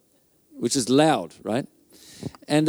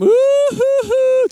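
high, loud hooting wail; exaggerated "hoo-hoo" crying; mock sobbing; 7 dB louder, much slower than usual. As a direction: imitate a man bawling in high hoots. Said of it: "he went from".

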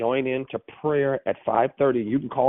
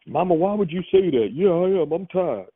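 higher-pitched imitation of a protesting son; baby brother's protest; 6 semitones higher than usual. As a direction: lightly act out a son arguing with mama. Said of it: "he called me the other day".

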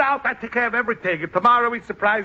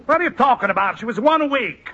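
put-on higher-pitched comic outrage, Italian accent; indignant correction; 5 semitones higher than usual. Father corrects friend about baby's age, indignant.

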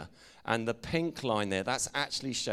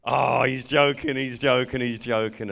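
put-on loud, relieved, laughing-off voice of the drunk lads; 10 dB louder than usual. The lads realising it's a joke, loud and relieved. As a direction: blurt it out in relief like a rowdy group.

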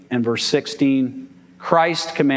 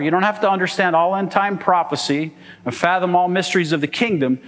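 ordinary talk

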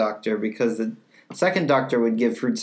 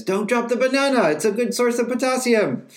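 higher, louder quoted Doctor voice; Ninth Doctor; 13 semitones higher, 4 dB louder than usual. Retold or imitated. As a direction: deliver it like an urgent Doctor line. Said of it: "the Ninth Doctor says".

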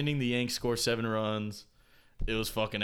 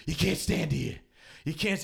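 lightly voiced guard, slightly raised; security guard order; 4 semitones higher than usual. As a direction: imitate a guard turning people away. Acts out stadium security guard.

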